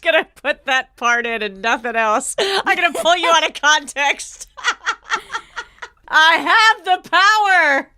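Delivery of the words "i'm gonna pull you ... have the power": mock-triumphant shout. Podcast host gloating about controlling the edit, mock-triumphant shout. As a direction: crow it loud and long, laughing around it.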